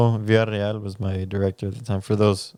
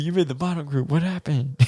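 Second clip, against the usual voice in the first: teasing teacher; 6 semitones higher than usual. Retold or imitated. Teacher's verdict retold again.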